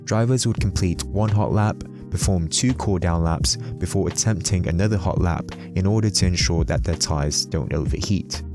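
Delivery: ordinary talk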